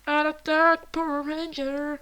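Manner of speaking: à l'attaque, lent et fort; cri de guerre héroïque. Pretend. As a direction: joue le super-héros qui charge. Se lance au combat. (cri de Power Ranger)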